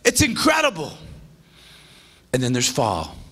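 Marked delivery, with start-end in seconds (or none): 0.0-1.0 crying out the promise from the pulpit; soaring preaching shout. Acted. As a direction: lift the whole room. Pastor preaching climax.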